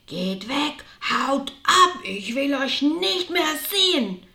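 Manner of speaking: explosive rage, high and loud; screaming beside himself. Acted. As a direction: roar at them to get out. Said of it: "Darum schrie er außer sich".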